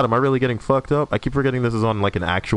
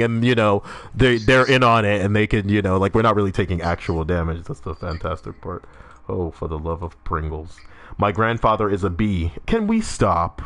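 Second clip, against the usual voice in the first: ordinary delivery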